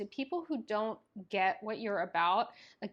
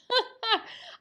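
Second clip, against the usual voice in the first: high laugh; 14 semitones higher, 7 dB louder than usual. Real, not acted. Emotional outburst laughing.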